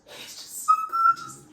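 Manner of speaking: very high, drawn-out swooning squeal; gushing squeal. Real, not acted. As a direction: squeal it out, loud and slow. Gushing over her crush.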